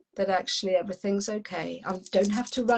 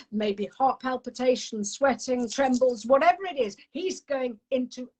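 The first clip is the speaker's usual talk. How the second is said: normal talking voice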